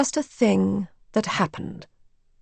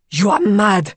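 loud, forceful protest; alarmed protest; 6 dB louder than usual. A narrator's reading. Raoul confronting the frantic mother, loudly protesting. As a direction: burst out in disbelief.

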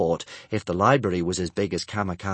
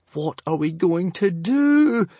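high, slow, loud wail; boy wailing to his friends; 10 semitones higher, 6 dB louder, slower than usual. A narrator's reading. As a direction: wail in despair. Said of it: "Hiccup wailed".

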